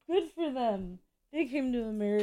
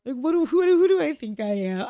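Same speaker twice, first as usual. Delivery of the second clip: mock panic; 4 semitones higher, 6 dB louder than usual. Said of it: "I'm just like".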